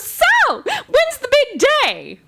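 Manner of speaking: very high, cheerful sing-out; cheerful photographer. Retold or imitated. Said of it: "she's all cheerful".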